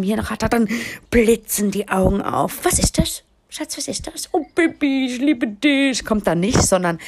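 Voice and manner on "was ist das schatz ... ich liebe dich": mock partner voice. Wife imitates partner's greedy delight.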